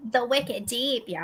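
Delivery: slow, bad Boston accent. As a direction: lay on a clumsy Boston accent. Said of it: "That was a horrible Boston accent".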